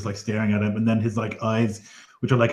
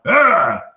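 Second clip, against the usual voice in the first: sharp high startled cry; a loud alarmed shout from the crowd; shocked cry; 9 semitones higher, 9 dB louder than usual. Acted. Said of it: "people who are like".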